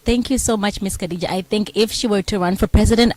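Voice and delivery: loud, projected event-host announcement; host sign-off. Acted. Host wrapping up the panel and announcing herself, projected and loud. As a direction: address the whole room like a conference host.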